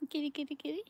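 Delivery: high, soft coaxing kitty call; soft high call to a stray cat. Real, not acted.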